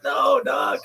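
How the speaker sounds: high, loud protest; exasperated no. Retold or imitated. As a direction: cry out no in disbelief.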